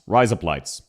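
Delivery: "Rise up lights" run together as Aussie razor blades; low, joined-up Aussie "Rise up lights"; Australian accent. Shown as a demonstration. Streamer showing how an Australian sounds, words run together low. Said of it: "how an Australian would say".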